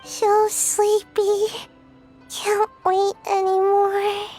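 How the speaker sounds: very slow, drawn-out tired whine; sleepy. Acted. Companion tired of waiting for the theme, sleepy. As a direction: drag every word drowsily.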